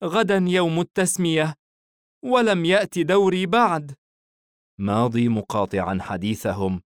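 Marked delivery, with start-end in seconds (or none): 0.0-3.9 put-on high-pitched child's voice, mildly sad; a touch of sorrow in a little boy's high voice. A narrator's reading. Child tells Hayim he is still unnamed, high and mildly sad. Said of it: "الطفل بحزن".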